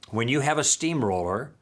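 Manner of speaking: ordinary talk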